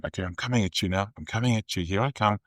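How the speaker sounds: very soft, casual walk-through; low-key rehearsal. Retold or imitated. Actor walking through the fight downstairs before the show, low-key. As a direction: mark the lines quietly, saving the performance. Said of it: "I'm just going".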